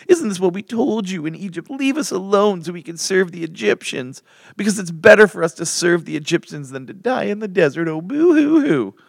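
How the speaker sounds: high, loud mock whining; whiny voice. Israelites begging Moses to leave them alone, mock whiny. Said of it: "I have to do this in a whiny voice".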